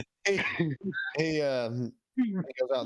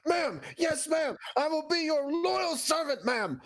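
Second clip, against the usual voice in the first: much higher, booming, loyal soldier; crusader voice. Acted. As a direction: shout your oath like a zealous crusader. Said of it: "throw my Crusader voice out there".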